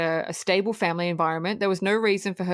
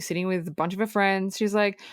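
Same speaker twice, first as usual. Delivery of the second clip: ordinary delivery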